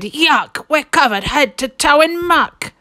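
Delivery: high, loud yuck of disgust; a thief squealing in disgust; disgusted Hugh. A narrator's reading. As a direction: spit it out, covered in muck. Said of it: "Hugh said".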